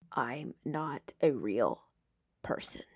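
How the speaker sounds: a hushed, broken insistence; quiet repeat. A narrator's reading. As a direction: say it again almost to yourself. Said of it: "he says again".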